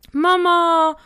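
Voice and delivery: high, loud, drawn-out howl; hammed-up child's cry; wailing. A narrator's reading. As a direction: wail for mother like a hurt little boy. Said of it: "heulte Jafet".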